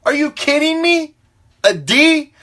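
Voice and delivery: incredulous parent venting over a D; exasperated parent. Retold or imitated. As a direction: play the fed-up dad yelling at his kid. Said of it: "and you're like".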